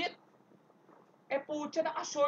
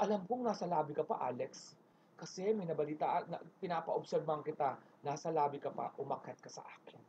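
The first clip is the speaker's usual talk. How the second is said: low, hushed boss, ending in a whisper; quietly firm, sinking to a whisper; Ma'am Charo voice; 5 semitones lower, 8 dB quieter than usual. Retold or imitated. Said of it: "Si Ma'am Charo". (pabulong na utos ng boss)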